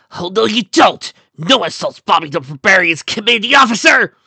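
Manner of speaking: high, loud battle cry; heroic shout. Acted. Bobby defying a flying attacker, heroic shout. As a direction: bellow it high and defiant. Bobby defends his officer mid-fight.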